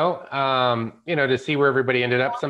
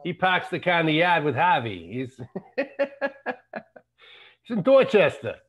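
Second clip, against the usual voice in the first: hammed-up Boston accent, breaking into a laugh; mock Boston local showing off his accent, laughing; 4 semitones higher than usual. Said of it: "I'll get my accent dialed in".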